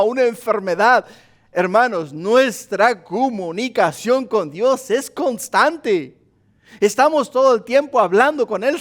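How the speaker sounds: high, loud, rising pulpit fervour; preaching at full force. Acted. Pastor urging the congregation, preaching at full force. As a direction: preach it out, pressing harder each line. Preacher builds about prayer in crisis.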